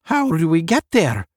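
high, loud question; crying out. A narrator's reading. Newborn nineteenth asking the others, crying out. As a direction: call out the question eagerly. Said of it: "the newborn nineteenth cried".

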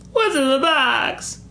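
high, drawn-out mock wail; mock anguished cry. Joking about the unopened box, mock anguished. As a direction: wail it slowly, hammed up.

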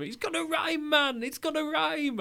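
put-on high, shouty Geordie accent. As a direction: yell it in a hammed-up Geordie accent. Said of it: "Was that your attempt at a Geordie accent?".

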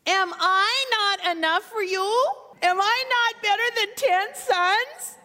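high, pleading husband's voice rising to a cry. Acted. Elkanah begging weeping Hannah to take comfort, high and pleading. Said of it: "Elkanah, her husband, said to her".

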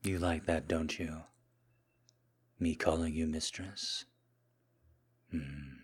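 soft, hushed, lingering murmur; submissive seduction. Acted. Kneeling man serving his Mistress, soft seductive submission.

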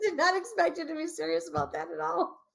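put-on high teenage voice, giggly disbelief; fifteen-year-old me. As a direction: play your shocked teen self. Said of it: "fifteen-year-old me came out and said".

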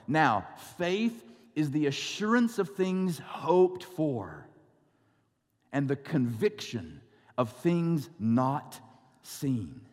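slow, measured scripture reading. Acted. Pastor reads Hebrews slowly to the congregation, measured. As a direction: read the verse slowly to the congregation.

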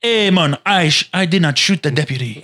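hammed-up Jamaican accent; playful mock Jamaican voice. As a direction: put on a comic Jamaican reggae-singer accent. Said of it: "That's my [name] impression".

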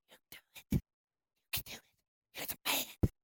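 hushed whisper of buddies urging him on; breathless whispered pep talk; whispered egging on. Retold or imitated. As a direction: whisper encouragement from behind.

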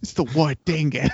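hammed-up Aussie announcement; mock Australian accent. Cohost playing along with the Australian gag, mock Australian accent. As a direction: announce it like an Outback ranger. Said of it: "we have to do it in Australian accents".

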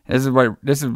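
ordinary delivery

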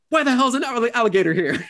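high, indignant sputter; huffy outrage. Retold or imitated. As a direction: squeak out the outrage, still huffing. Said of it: "he's all in his huff".

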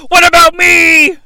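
high, loud mock wail; imitated high-pitched shout; shouted catchphrase. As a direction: yell it like a wrestler's signature whine. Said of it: "Which is his thing".

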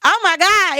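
thrilled exclamation; excited. Real, not acted. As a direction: gush it with real excitement. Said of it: "I'm still excited".

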